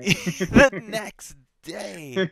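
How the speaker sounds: laughing through the words, slow and drawn out. Real, not acted. Echoing his cohost's joke while cracking up, laughing. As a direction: echo it while cracking up, pitch jumping high.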